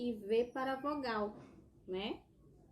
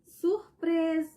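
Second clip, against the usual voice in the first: high, loud, slow drawn-out cry of surprise; spring the surprise on the birthday child; surprise shout; 8 semitones higher, 9 dB louder, much slower than usual. Acted. Teacher voicing the mother at a birthday party, high stretched surprise shout.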